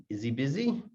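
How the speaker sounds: "Is he" said as "izzy" before "busy"; softly linked "izzy busy"; dropped H. Shown as a demonstration. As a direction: lose the H in "he" and link it to "Is". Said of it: "the H will just disappear".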